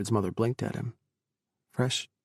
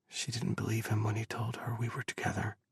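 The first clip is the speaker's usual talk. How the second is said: hushed, breathless whisper; 7 dB quieter than usual. Acted. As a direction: whisper it, barely voiced. Narrator whispers character line.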